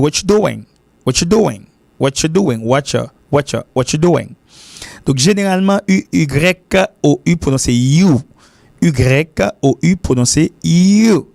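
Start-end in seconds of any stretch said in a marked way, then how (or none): 0.0-4.3 "what you doing" as "watcha doing"; casual American reduction. Shown as a demonstration. Teacher imitating what Americans say, casual reduction. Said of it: "on va entendre un peu l'américain dire". 6.1-11.3 "you" drawn out with a full long oo; dictionary pronunciation. Shown as a demonstration. Teacher giving the dictionary form, long full "you". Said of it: "C'est ça la prononciation".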